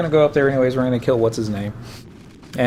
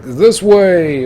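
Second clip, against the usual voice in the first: loud, high mock leader's call; hammed-up rallying cry; put-on friend voice; 6 semitones higher, 8 dB louder than usual. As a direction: call out like an overconfident guide. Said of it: "He doesn't talk like that".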